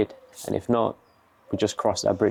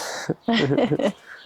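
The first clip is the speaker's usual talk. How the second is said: high laugh; 11 semitones higher than usual. Real, not acted. Laughs after sliding pizza in.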